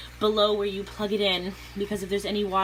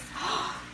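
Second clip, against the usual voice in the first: gasp; 5 dB quieter than usual. Real, not acted. Startled as pump starts.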